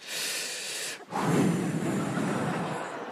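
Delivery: long, breathy sigh, all doom; heavy mock sigh. A pilot about to announce trouble, heavy sigh. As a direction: sigh like a pilot bringing bad news. Pilot's gloomy look shown.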